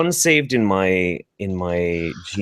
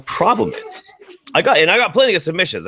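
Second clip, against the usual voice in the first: high dramatic; 9 semitones higher, faster than usual. Real, not acted. Dramatic lead into the problem.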